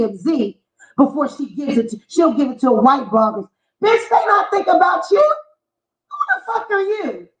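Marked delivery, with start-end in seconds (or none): 3.8-7.3 mocking. Mocking the rival blogger.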